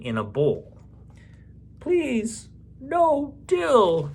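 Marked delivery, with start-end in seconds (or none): 1.8-4.1 put-on high child voice begging; pleading child. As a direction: beg like a small kid at the table.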